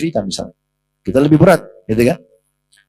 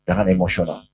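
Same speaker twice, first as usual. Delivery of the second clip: much softer and lower, calming a friend; calm, soothing; 6 semitones lower, 6 dB quieter than usual. Retold or imitated. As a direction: hush an angry companion gently. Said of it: "Nabi dengan tenang memberikan isyarat".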